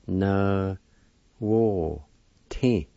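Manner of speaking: each syllable said slowly and apart: Na, wo, ti. Shown as a demonstration. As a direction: break Na wo ti into separate beats. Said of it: "First part is pronounced like this".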